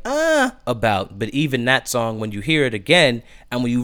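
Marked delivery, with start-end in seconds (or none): 0.0-0.5 high, drawn-out noncommittal "uh"; unsure "uh". Retold or imitated. Host admitting doubt about one song, unsure. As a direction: hedge with a high-pitched, so-so "uh". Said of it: "Maybe one song I was like".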